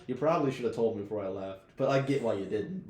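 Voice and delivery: ordinary talk, nothing marked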